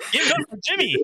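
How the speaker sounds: high-pitched mock MC shout-out; playful hype. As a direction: call for applause, laughing and high. Playfully calling for applause for Jimmy.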